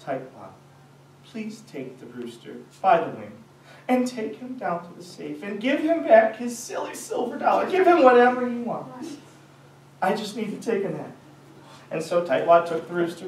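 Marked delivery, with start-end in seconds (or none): 0.0-11.1 nicest voice. Acted. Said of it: "in his nicest voice".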